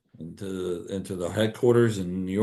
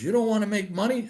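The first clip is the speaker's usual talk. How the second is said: pitched way up in mock disbelief; incredulous high mock; 12 semitones higher, faster than usual. Recruiter calling after him, high incredulous mock. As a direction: squeak it like he can't believe you'd leave.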